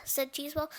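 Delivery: plain reading voice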